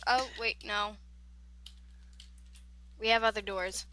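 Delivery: normal talking voice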